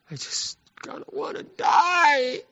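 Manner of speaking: high, slow self-pitying whine; whiny Elijah. Acted. Preacher mocks Elijah's pity party, high whine. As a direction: moan it like a sulking prophet.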